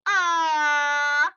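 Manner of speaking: high, loud cooing aww; a cooed aww, high and loud. Acted. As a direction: coo over something cute. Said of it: "you hear a voice".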